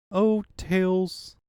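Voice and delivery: ordinary talk, nothing marked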